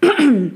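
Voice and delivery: short polite throat clear; brief attention-getting "ahem". Acted. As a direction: clear the throat before speaking up. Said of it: "se racla la gorge".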